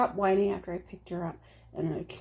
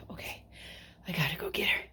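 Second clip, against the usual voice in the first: whisper; 5 semitones lower, 7 dB quieter, much faster than usual. Retold or imitated. Retelling her own decision.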